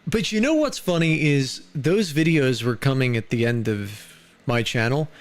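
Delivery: normal talking voice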